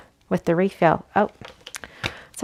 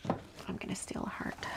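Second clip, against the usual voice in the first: whispering; 17 dB quieter than usual. Real, not acted. Sneaky aside about stealing.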